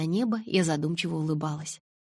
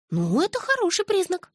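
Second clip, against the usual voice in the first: very high-pitched approval; approving friend; 14 semitones higher, 4 dB louder than usual. A narrator's reading. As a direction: pitch the verdict way up.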